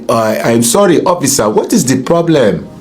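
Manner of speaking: put-on low, polite West African accent. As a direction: talk to the officer in an African accent. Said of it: "I used to try to use that African accent".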